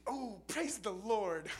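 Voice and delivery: high, loud, slow mock praise; mock exclamation. Mocking a brother who praises after stubbing his toe, hammed-up. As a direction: ham up the pious cry.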